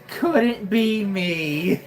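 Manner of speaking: usual speaking voice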